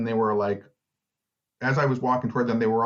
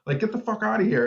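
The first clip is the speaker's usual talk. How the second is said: much higher, furious telling-off; retold angry outburst; 10 semitones higher than usual. Yelling at people mocking a hurt pigeon, high-pitched fury. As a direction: chase the jeering crowd off, outraged. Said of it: "verbally attacked all these people".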